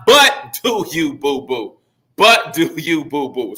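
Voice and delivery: slow line that drops away at the end; poem recitation. Shown as a demonstration. Teacher reciting the last line of his haiku, slow with a falling finish. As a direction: recite it slowly, letting the last word fall.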